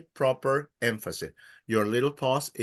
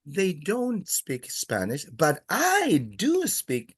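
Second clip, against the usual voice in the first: slow, with "do" pushed high in "but I do speak"; emphasis on DO; 3 semitones higher, slower than usual. Shown as a demonstration. Teacher demonstrating emphasis to students, "do" stressed. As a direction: lift and hold "do" to stress it. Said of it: "If I wanted to emphasize".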